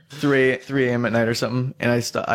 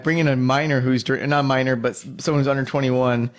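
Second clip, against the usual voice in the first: ordinary talk, nothing marked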